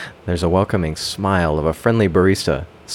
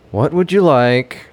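a touch of condescension, higher and louder; hint of superiority; 8 semitones higher, 5 dB louder than usual. Barista greeting a dithering customer, faintly superior. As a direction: ask like you're slightly above the customer. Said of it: "he asks with just a hint of superiority".

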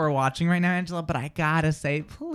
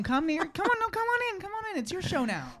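very high, soft beckoning; mock coaxing; 12 semitones higher, 8 dB quieter than usual. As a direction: coax her in as if tiny and timid. Mock coaxing co-host onto the show.

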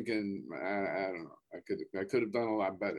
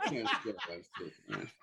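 laughing through the words; 4 semitones lower, 5 dB quieter than usual. Real, not acted. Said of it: "laughing while speaking".

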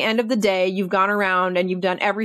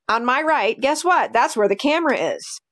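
ordinary talk

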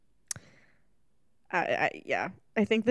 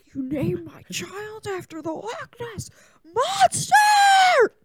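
high, breaking outraged yell; outraged shout; 12 semitones higher, slower than usual. Acted. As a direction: scream it in mock outrage. Outraged mock Bella outburst.

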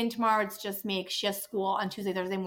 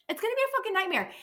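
exasperated outburst; 10 semitones higher, faster than usual. Real, not acted. Repeats line higher, faster.

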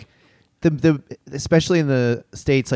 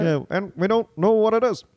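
imitating ex-president; 4 semitones higher than usual. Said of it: "was that a good".

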